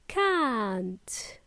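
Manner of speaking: CAN'T with a drawn-out ah; slow British CAN'T; British long ah. Shown as a demonstration. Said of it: "with a long ah".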